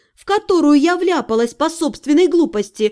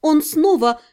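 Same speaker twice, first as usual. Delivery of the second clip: usual speaking voice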